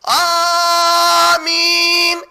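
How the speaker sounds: long, drawn-out, very loud amen; hammed-up bellowed amen; mock screamed amen. Shown as a demonstration. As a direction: show the shouted amen again. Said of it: "ایسا چیخ کے نہیں بولتے،".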